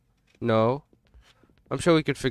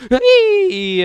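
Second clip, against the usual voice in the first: sudden high yelp; startled shout; 9 dB louder than usual. Real, not acted. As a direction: yelp in surprise. Spots monster.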